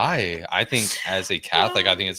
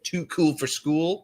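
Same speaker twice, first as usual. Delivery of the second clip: mock mom voice; 9 semitones higher, slower than usual. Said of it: "they're like".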